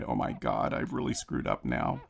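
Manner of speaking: hushed self-reproach under the breath; mortified whisper. Retold or imitated. Mortified inner thought aside.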